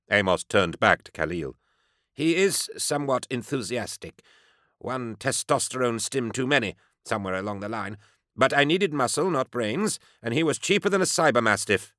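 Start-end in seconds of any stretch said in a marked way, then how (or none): 2.1-11.9 high, pompous explaining voice; haughty querulous doctor. A narrator's reading. Doctor excuses his bodyguard to the enforcer, haughty. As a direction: explain it away with piqued authority.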